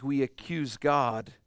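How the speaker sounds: usual speaking voice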